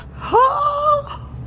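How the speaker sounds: a sharp gasp of delight; amazed gasp. Real, not acted. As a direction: gasp as you walk into the suite. First sight of upgraded suite.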